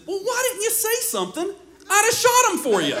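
high, matter-of-fact offer; deadpan homeowner. Retold or imitated. Homeowner offering to shoot the thieves, high deadpan. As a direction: say it dead serious, helpful. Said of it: "serious as he could be".

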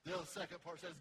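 usual speaking voice

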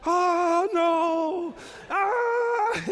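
high loud mock baby wail; hammed-up toddler panic; imitating screaming babies. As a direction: squeal like a distressed toddler. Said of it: "horrified look in their eyes".

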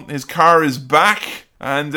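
ordinary talk

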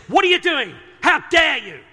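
high, loud outburst as an angry Jesus; indignant anger. Acted. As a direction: vent your anger in an indignant shout. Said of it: "to give vent to your anger".

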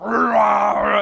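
loud roaring stomach-growl imitation; drawn-out mock growl. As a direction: roar like an empty, rumbling stomach. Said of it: "my stomach is like".

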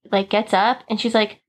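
ordinary delivery

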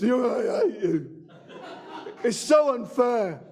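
put-on slow, high, whiny sulking teen; Kevin the teenager impression. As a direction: drag it out like a moody adolescent. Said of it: "That's my only impression".

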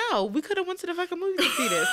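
high, fast, laughing rant; laughing outrage. Retold or imitated. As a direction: blurt it out, cracking up. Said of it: "I'm like".

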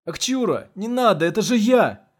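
высокий отчаянный крик, умоляя; кричи, умоляя о пощаде; мольба с криком. A narrator's reading. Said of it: "крикнул Игорь с мольбой". (отчаянный умоляющий крик)

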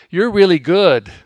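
loud, falling "You're really good"; big brother's praise. Retold or imitated. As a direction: boom it out like an older brother. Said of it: "That's what he'd say to me".

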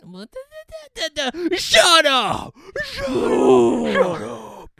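stammering then screaming shut up; splutter, then scream it out; flustered shouting. Acted. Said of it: "He'll go".

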